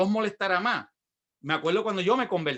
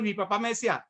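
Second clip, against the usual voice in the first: ordinary talk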